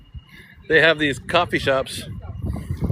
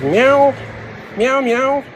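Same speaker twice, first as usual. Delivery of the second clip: questioning high meows; cat meow; 9 semitones higher, much slower than usual. Pretend. To the shop worker, meowing to mean cats. Miming cat to non-English speaker.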